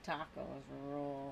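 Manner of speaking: a go at a Bush-style Texan accent; best-attempt George W. Bush accent, not quite landing. Shown as a demonstration. Asked by a viewer to do Bush, attempted Bush accent. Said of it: "Let me do my best attempt".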